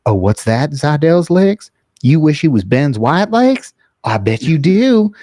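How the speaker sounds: slow, high singsong teasing; teasing taunt. Retold or imitated. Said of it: "He goes".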